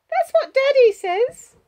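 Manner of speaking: put-on high, loud little girl; child's voice. A narrator's reading. Child citing Daddy as authority, high child voice.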